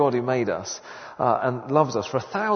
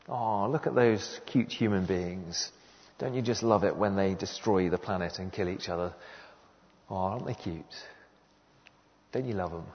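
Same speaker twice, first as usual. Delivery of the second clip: mock sentimental; 6 semitones lower, 6 dB quieter than usual. Preacher parodies sentimental love for humankind, cooing mock-tenderly. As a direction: coo softly with syrupy mock affection. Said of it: "a sort of sentimental sort of love".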